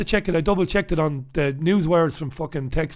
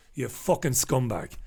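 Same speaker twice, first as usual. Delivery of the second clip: muttered insult; 7 semitones lower, 11 dB quieter than usual. Real, not acted.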